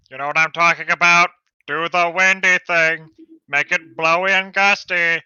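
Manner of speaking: urgent. Acted. Urging hero.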